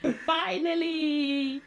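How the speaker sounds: high, slow, delighted cheer; happy yay. Real, not acted. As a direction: cheer with real joy. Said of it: "Oh, I'm so happy".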